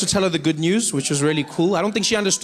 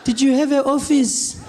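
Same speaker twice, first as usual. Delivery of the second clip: skeptical granny; 5 semitones higher, slower than usual. Retold or imitated. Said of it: "She's like".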